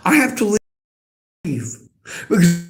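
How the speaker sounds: crying, words breaking off; real tears choking the words; sobbing out that she has to leave. Real, not acted. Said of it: "I am too worked up to be here".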